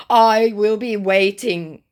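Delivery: high, loud, slow mock pomposity; self-righteous. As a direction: draw it out like a self-righteous scammer. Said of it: "all self-righteousness".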